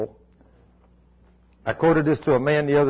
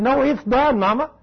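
put-on high, raised child's voice, defiant; little boy refusing; 10 semitones higher, 3 dB louder than usual. Preacher voicing Cody refusing his mother, high and firm. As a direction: plead firmly like a six-year-old.

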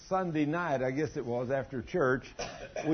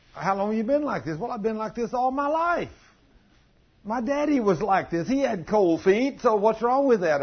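defensive church member; 6 semitones higher than usual. Retold or imitated. Voicing a congregant's defensive answer.

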